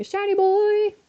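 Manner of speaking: excited high squeal; excited shout. Real, not acted. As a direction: shriek with delight at the shiny. Excited catch shout at game.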